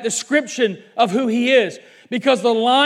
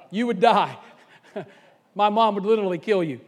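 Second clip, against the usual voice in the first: amused chuckle through the words; laughing as you exaggerate the danger; 3 semitones lower, 4 dB quieter, faster than usual. Real, not acted. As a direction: say it through a real laugh, a little lower. Laughs while joking about his mom.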